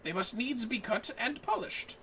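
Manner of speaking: lightly voiced formal scholar, measured and courteous; NPC quest-giver voice. Streamer voicing the quest NPC to her co-op partner, lightly formal. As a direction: read it like a polite old-world sage.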